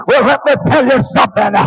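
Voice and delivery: normal talking voice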